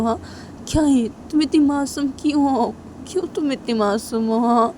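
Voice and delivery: pleading, emotional fretting; exasperated, fond Tisha. Acted. Tisha asks Shanaya why she is so innocent, exasperated.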